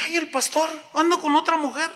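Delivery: very high-pitched mock shock; scandalized gasp. Hermanita seeing pastor with another woman, scandalized. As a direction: react as a shocked gossip.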